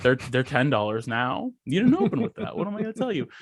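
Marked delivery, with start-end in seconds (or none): 1.7-3.3 mock helplessness. Mocks the clerk's excuse.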